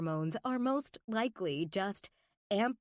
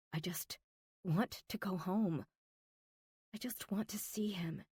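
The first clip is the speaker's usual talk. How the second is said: whispering; 5 dB quieter than usual. A narrator's reading. Alone, longing for Harrison.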